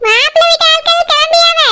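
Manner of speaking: high, carrying call across the house. Acted. Mother calls Alamelu to bring the bride, high carrying call. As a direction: call her from the far room.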